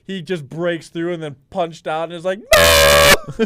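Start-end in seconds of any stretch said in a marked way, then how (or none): 2.4-3.4 huge high "no" wail; re-enacted full-volume cry of despair; retold anguished scream. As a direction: scream it as the game slips away. Imitates reaction to ball punched out.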